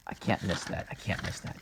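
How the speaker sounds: hushed self-reminder; muttering whisper. Acted. Character searching the accident scene, muttering whisper. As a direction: mutter it softly under your breath.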